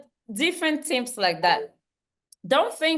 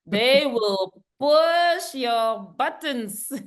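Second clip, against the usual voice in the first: slow emphatic repeat; 2 semitones higher, much slower than usual. Shown as a demonstration. Speaker to audience, slowly stressing the warning. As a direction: push your buttons drawn out slowly.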